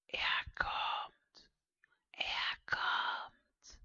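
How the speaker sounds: loud, unvoiced stage whisper; eerie whisper. Shadows warn of someone coming in the dark castle, eerie whisper. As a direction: hiss it slowly like a menacing shadow.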